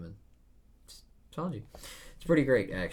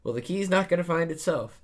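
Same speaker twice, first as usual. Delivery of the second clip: much higher and louder, breezy; Luffy voice; 10 semitones higher, 10 dB louder, faster than usual. Acted. Luffy brushing off the old man, much higher and louder. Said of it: "'cause Luffy's like".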